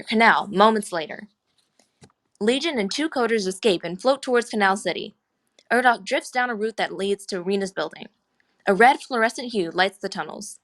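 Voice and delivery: normal talking voice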